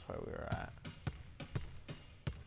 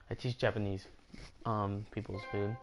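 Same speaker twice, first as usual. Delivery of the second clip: normal talking voice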